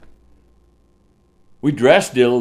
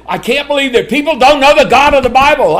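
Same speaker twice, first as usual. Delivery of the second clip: retold exclamation; 10 semitones higher, 6 dB louder than usual. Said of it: "he said".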